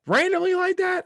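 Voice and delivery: a sudden high squeal for the random jab; squeaky mock voice. Mocking a random pop shot at South Carolina, in a high squeal. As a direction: jump way up high and loud on randomly like that.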